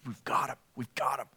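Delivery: soft, gloating we-got-him; eager council whisper. Retold or imitated. Preacher voicing the council's eager glee. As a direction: hush it like they've finally caught him. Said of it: "you can see their anticipation".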